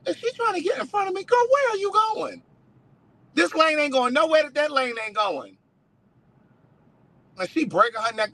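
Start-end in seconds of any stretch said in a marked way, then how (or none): 0.1-5.6 high, fast indignant rant at a car; exasperated driver. Retold or imitated. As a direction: fuss at the car cutting in. Imitating a pushy driver.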